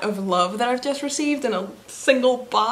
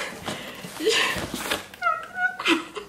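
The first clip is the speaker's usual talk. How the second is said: high breathy laugh tipping into a cry; laughing sob; 6 dB quieter than usual. Real, not acted. A YouTuber half laughing, half crying over a gift box. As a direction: let a happy laugh crack into tears.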